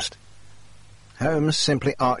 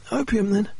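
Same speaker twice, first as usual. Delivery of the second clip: a much higher, pained voice for the injured Jacob; injured man's voice; 11 semitones higher than usual. Acted. Injured Jacob pleading with the doctor, higher pitched voice. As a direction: voice Jacob in strained higher register. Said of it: "Jacob said".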